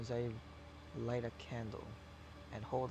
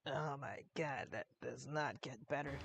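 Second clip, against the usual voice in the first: strained, hoarse voice after coughing; 6 dB quieter than usual. Real, not acted. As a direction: croak it out with a sore throat. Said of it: "my throat is killing me 'cause I have to cough".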